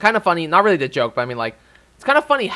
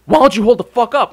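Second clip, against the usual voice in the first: loud, higher mock snarl of a rude man; quoting a rude paramedic's loud snarl; angry retort; 7 semitones higher, 6 dB louder than usual. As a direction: throw his rude snap back, loud. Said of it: "he gives me out".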